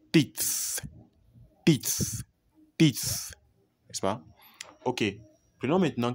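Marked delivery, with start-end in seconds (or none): 0.1-3.3 teeth ending in breathy voiceless th, said high. Shown as a demonstration. Teacher drilling teeth to students. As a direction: hiss the th of teeth between the teeth. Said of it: "un peu difficile à prononcer".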